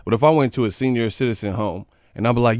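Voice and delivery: usual speaking voice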